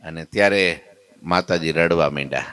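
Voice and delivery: ordinary talk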